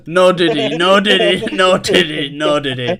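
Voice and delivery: loud, high repeated disclaimer; amused protest. Real, not acted. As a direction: blurt it out over the laughter. Protesting over laughter at joke.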